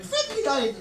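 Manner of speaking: high, lilting Kerry brogue; hammed-up Kerry priest greeting; sing-song stage-Irish Kerry voice. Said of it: "I met a Kerry priest".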